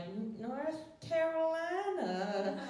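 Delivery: put-on high, slow Southern accent. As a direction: stretch it out in a thick Southern accent. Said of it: "if you match their accent and their cadence".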